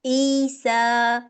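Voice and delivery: ईसा very slowly, both long vowels held two counts; slow recited ईसा; Quran recitation. Shown as a demonstration. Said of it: "तो मैं इसको कैसे पढ़ूंगी?".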